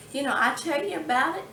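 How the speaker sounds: ordinary talk, nothing marked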